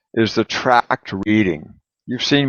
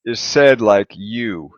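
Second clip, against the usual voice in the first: slow, careful model; 6 dB louder, slower than usual. Shown as a demonstration. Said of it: "This first part".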